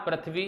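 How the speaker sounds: पृथ्वी said wrongly as "prath-vi"; children's mispronunciation. Retold or imitated. Teacher quotes how children read this word, mispronunciation. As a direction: open "pra" at the start of पृथ्वी. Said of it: "मैं कहता हूँ पृथ्वी नहीं".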